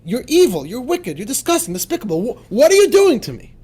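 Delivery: high, rising anger that bursts loud at the end; angry berating. Shown as a demonstration. Teacher voicing the soul's rebuke, angry. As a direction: spit the insults, then explode in outrage. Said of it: "Get yourself mad at your animal soul".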